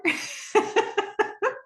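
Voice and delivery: high airy burst of laughter; breathless giggle; real laugh. Real, not acted. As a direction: break up laughing at the scene. Laughing at the doctor's prank.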